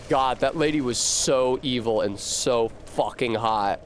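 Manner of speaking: ordinary delivery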